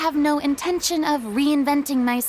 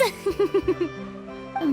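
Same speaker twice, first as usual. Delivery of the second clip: haughty little laugh; 5 semitones higher, 4 dB quieter than usual. Acted. Boasting of past stage glory.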